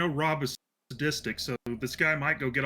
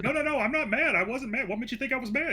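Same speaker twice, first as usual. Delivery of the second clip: high, insistent denial; mock protest; 10 semitones higher than usual. Retelling to coworker, high protesting denial. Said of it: "It's like".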